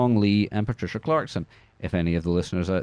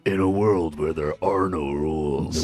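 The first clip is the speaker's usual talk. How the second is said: trailer voice-over; 5 semitones lower, slower than usual. Pretend. Movie trailer voice-over parody.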